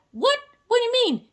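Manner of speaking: What? pitched high and startled; mock shock. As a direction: play the stunned humans. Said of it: "And we're like".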